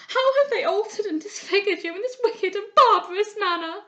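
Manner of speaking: high, loud wailing that keeps climbing; girl lamenting at a bedside, near tears; grieving lament. A narrator's reading. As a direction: pour out grief over her ruined friend. Lament over disfigured friend.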